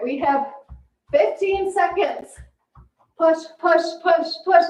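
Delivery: high, loud coaching push; aerobics instructor. Real, not acted. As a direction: pump them up through the last seconds. Workout coach pushing final seconds.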